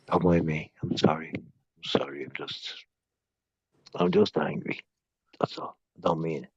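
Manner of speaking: soft, low murmured sorry; quiet apology. Real, not acted. Caller apologizing to friend after angry outburst, softly. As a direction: hush it down to a whispered apology.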